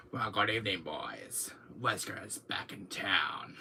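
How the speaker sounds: whispered villain intro; Wesker impression. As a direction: drop into a low menacing hush. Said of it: "new intro for my channel".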